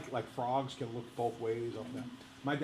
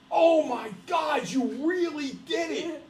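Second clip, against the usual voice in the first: high, loud, slow disbelief; mock shocked sister; 14 semitones higher, 8 dB louder, slower than usual. Sister looks up from the floor, hammed-up high shock. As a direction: imitate a stunned sister in a raised voice.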